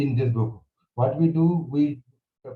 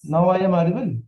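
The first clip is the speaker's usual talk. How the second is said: louder voice; 6 semitones higher, 8 dB louder than usual. Real, not acted. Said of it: "I will increase my voice".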